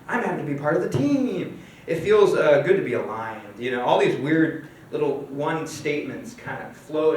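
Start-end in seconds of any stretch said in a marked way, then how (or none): 0.1-1.6 lightly voiced cheerful office chatter; mock upbeat group chatter with a laugh; chattering employees. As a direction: act out a hint of contented coworker babble. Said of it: "you can hear all the employees".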